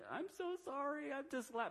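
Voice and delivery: tiny, very high, soft squeak; mock helpless apology. Helpless laugher apologising in his mind, mock squeaky apology. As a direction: apologise in a small high voice, as an inner thought.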